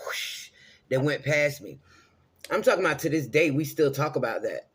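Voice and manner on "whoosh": short soft rush of air; a light fast swish; quick whoosh. Shown as a demonstration. Showing with her hand how the orb passed.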